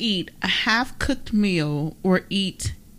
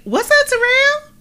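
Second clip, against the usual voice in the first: bright high greeting, much louder; high, loud sing-song greeting; 7 dB louder than usual. Shown as a demonstration. To Terrell, showing how you say it matters: high and loud. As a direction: pitch it way up and loud. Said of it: "Then I can say".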